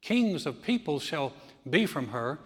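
normal talking voice

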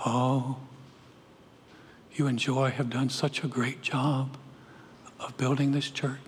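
low, soft, gushing compliment, imitated; soft admiring praise. Churchgoer praises pastor for building the church, soft imitated admiration.

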